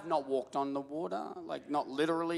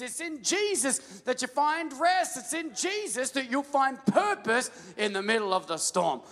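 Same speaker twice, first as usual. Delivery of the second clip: high, loud rousing preacher; full-force preaching; 7 semitones higher, 3 dB louder than usual. Acted. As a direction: drive each line home to the crowd.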